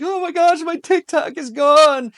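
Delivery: high, loud, whiny mock freakout over a lost app; overdone wail of a panicking fan; mock panic. Mocking fans who panicked over TikTok, shrill mock wail. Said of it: "people were freaking out".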